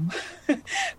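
a sudden high burst of laughter; squeaky little laugh. Real, not acted. Laughing at own letdown.

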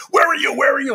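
high-pitched mock scream of a frantic wife; imitating screaming wife. Wife screaming at husband down the phone, high mock scream. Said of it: "she starts screaming at me".

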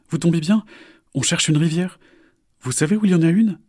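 high, trusting and eager; naive question. Acted. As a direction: ask innocently. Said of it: "demande naïvement Isor".

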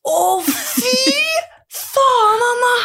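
mock anguished cry of disgust; brother's disgusted outcry. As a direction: squeal it out in disgust like the smell hit you. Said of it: "Da smeller jo fra han da".

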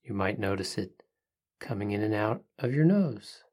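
soft spa whisper. Acted. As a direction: hush it down, calm and unhurried. Leads a guided breathing meditation.

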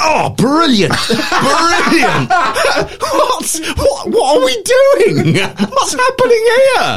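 high, giggly mock excitement, laughing through it; laughing imitation. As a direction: gush and crack up mid-quote. Matt's giddy quote, laughing.

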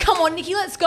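high, loud urging; eager mum. Retold or imitated. Mum urging daughter onto the ranch, high and loud. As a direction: push her to go, excited. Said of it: "She's like".

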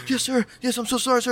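high, scared meek apology. Retold or imitated. Kid apologizing to the furious teacher, high meek voice. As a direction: grovel like a caught kid. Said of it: "I go".

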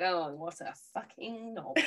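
imagined sneer under the breath; muttered contempt. Retold or imitated. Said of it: "you could just see them like".

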